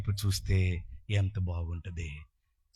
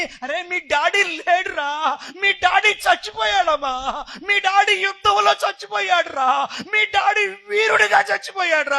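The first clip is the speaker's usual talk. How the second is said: anguished mother, preacher-acted; 12 dB louder than usual. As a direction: cry out the bad news to your son. Mother tells son father died. (గొంతెత్తి ఆవేదనతో చెప్పే తల్లి)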